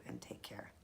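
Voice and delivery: whispered goodbye; soft whispered farewell to viewers. Real, not acted. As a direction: breathe the sign-off out softly. Signing off the video.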